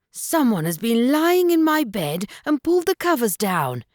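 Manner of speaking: a touch of a growl, big bear; heavy growling bear. A narrator's reading. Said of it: "he growled with his heavy voice".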